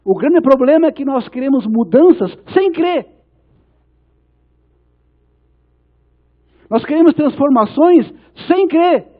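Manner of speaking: loud, high, driving sermon delivery; impassioned preaching. Real, not acted. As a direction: preach it forcefully, building to each punchline. Preaches at full force. (pregação inflamada, em voz alta)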